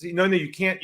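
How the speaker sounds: ordinary talk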